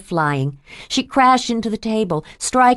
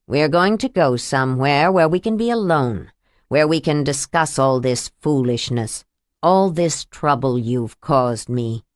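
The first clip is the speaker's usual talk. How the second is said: menacing abuser; 5 semitones lower than usual. Acted. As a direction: lower slowing villain voice. Abuser voiced low, menacing.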